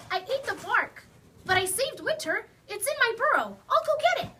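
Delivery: rushing off with excitement building; eager. Acted. Rabbit telling Hedgehog he saved winter, eager.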